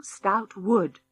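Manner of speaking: ordinary delivery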